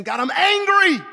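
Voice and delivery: God, I'm angry shouted high and loud; angry prayer. Acted. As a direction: cry out the anger at God.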